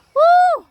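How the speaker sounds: very high, loud whooped "woo"; calling out. Real, not acted. Vlogger answers the shouting cousin, high holler. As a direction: holler so it carries across the field. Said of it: "sinigawan ko".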